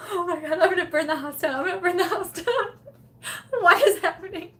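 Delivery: high, frantic wail on the edge of laughter; mock panic. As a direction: act out hammed-up kitchen hysteria. Acted panic about burning house down.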